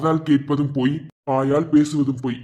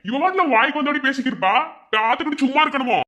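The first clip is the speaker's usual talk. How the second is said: indignant outburst; 10 semitones higher than usual. Acted. Protests to elder brother about wife.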